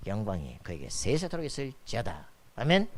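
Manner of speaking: ordinary talk, nothing marked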